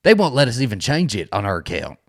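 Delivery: ordinary talk, nothing marked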